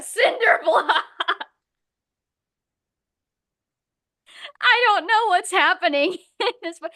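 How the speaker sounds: words breaking into a real laugh; laughing in disbelief. Real, not acted. Reacting to the absurd book, real laughter. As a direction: laugh helplessly through it.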